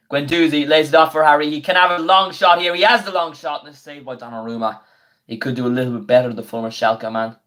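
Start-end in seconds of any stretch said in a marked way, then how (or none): 0.1-4.8 higher, louder call of the long shot; play-by-play commentary. Acted. Streamer calling a long shot for viewers, excited play-by-play. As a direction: build it up like a TV commentator as the shot comes.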